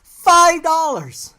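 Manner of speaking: loud, high, drawn-out disbelief; stretched-out cry of outrage; outraged exclamation. Real, not acted. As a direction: hit the price slowly, scandalized. Outraged at price of water.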